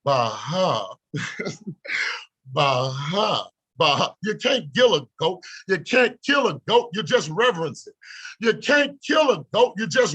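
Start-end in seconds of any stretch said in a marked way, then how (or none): 0.0-4.1 low chuckle. Real, not acted. Laughing after the climax.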